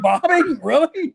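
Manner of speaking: half-joking gasp of disbelief; high laughing really?. Real, not acted. Reacting to Rolex joke.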